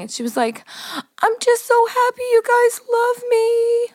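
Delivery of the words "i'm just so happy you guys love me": high, slow tearful squeal; Carolyn crying. Retold or imitated. Friend imitating Carolyn touched at being loved, mock happy sobbing. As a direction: overdo happy tears, pitched way up. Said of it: "she started crying".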